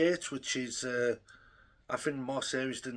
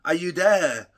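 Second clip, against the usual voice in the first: higher, louder mock police calling; 4 semitones higher, 6 dB louder than usual. Ranting about police at his door, mocking their calls. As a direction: parrot the officers' shouting. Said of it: "ram raiding on my door saying".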